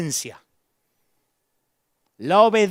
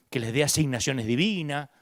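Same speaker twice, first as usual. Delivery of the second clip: usual speaking voice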